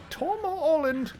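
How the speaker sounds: tiny high-pitched mock British boy; poor little Tom Holland voice. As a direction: coo it like a pitying mock of a small British lad. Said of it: "doing my poor little Tom Holland impression".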